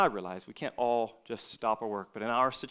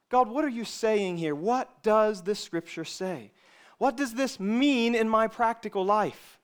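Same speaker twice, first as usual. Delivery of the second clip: raised, higher voice with drawn-out emphasis; praying out loud; 8 semitones higher, 6 dB louder than usual. Shown as a demonstration. Preacher showing how to question God over a verse, out loud and emphatic. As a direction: ask God insistently, stretching the key words. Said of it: "say it even out loud".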